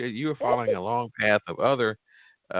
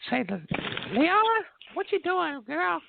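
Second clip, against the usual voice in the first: country woman voice. Retold or imitated. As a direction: call out like a country auntie. Country grandma calling from truck.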